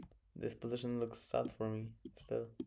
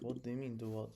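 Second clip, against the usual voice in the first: ordinary talk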